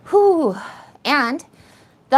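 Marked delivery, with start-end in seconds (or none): 0.1-0.6 high, loud whew; heavy exhale. Real, not acted. Streamer pulling herself together on a livestream, loud exhale. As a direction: blow out a big steadying breath. Collecting herself after crying.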